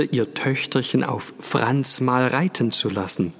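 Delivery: usual speaking voice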